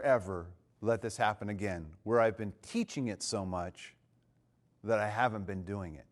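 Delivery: low, soft, sober resolve; subdued confession with pauses; retold humbled vow. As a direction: admit it quietly, letting the pauses sit. Retold humbled vow from fellow speaker.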